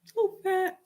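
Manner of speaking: high, whiny put-on voice; mock whiny self. Mocking her younger self crying about weight, high whine. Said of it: "I cried about my insecurity".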